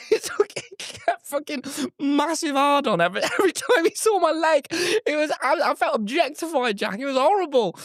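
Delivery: high-pitched comic exasperation; appalled squeal. Real, not acted. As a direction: rant in a squeaky, incredulous register. Exasperated, appalled retelling of ordeal.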